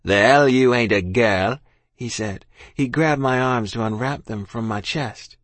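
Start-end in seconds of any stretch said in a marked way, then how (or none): 0.0-1.6 loud, slow contradiction; scornful soldier. A narrator's reading. Soldier disbelieving child's denial, loud and scornful. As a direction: throw it back loudly, drawn out.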